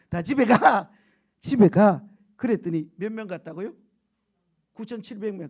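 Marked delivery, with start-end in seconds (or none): 0.1-2.1 loud, blunt order to go home; loud dismissal. Retold or imitated. Preacher voicing God dismissing the greedy drinkers, loud. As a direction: shoo them out loudly.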